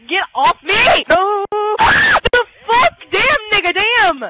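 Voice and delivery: loud, high outburst; real heated shouting; frustrated yelling. Real, not acted. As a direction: yell in exasperation at the game. Yelling at the game.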